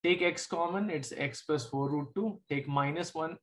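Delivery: usual speaking voice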